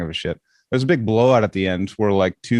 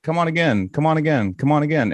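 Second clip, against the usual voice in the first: tag eager coaxing; 6 semitones higher than usual. Retold or imitated. Retold podcasters eagerly urging him back.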